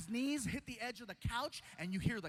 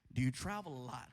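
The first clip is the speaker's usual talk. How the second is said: much lower doctor voice; 10 semitones lower than usual. Retold or imitated. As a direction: ask gravely, deep in pitch, as the doctor. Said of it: "And then he asked".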